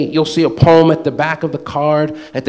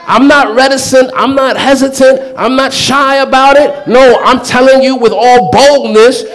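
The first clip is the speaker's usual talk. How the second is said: higher and louder, full of boldness; bold declaration; 6 semitones higher, 5 dB louder than usual. Retold or imitated. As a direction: proclaim it with total confidence. God declaring boldly to the listener.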